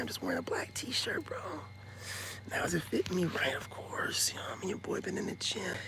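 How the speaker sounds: soft unvoiced whisper; hushed boastful aside; whispered brag. Real, not acted. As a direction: whisper it close to the mic. Whispering brag about his look.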